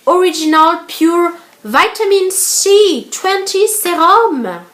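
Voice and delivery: put-on slow, careful English accent on the product name. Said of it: "avec mon accent anglais de malade". (accent anglais appuyé)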